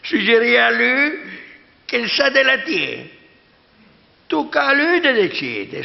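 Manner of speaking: indignant. Acted. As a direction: rise up in offended protest. Indignant priest protests.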